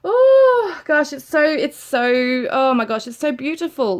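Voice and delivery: high, drawn-out gush of emotion; overcome, stretching the words out; moved, starting high and loud then settling; overwhelmed. Real, not acted. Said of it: "the emotion comes through, like, so overwhelming".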